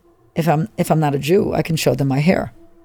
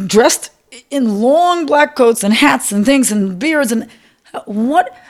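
upset outburst; 8 semitones higher, slower than usual. Real, not acted. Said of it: "I'm choking up thinking about it".

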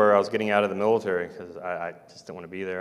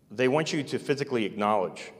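ordinary talk, nothing marked

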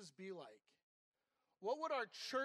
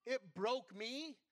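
emotional; much slower than usual. Real, not acted. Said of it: "I'm getting emotional thinking about it".

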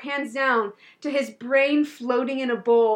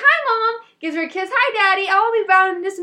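higher, bright-eyed teen greeting, mock sweet; chirpy Tammy; 7 semitones higher, 4 dB louder, faster than usual. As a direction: play the cheery daughter coming home. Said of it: "She's like".

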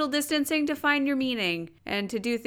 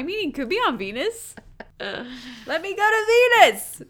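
higher, louder mock plea; mock pleading; 5 semitones higher, 4 dB louder than usual. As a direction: beg playfully to be sent to Venus. Pleading jokingly to go to space.

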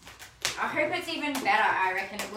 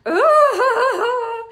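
delighted squeal; 13 semitones higher, 12 dB louder than usual. Real, not acted. Seeing her book.

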